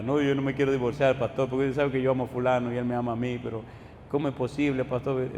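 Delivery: hint of weeping, understated; retold crying. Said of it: "Y empezó a llorar". (insinuación de llanto, más suave y rápido)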